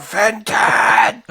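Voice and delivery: high yelled call across a park; calling the dog. Retold or imitated. Owner yelling for a runaway dog, loud high call. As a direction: bellow the dog's name from far off. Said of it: "It's like".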